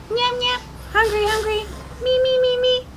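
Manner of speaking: high, eager toy animal voice; hungry puppet voice. Pretend. Parent voicing a hungry puppet.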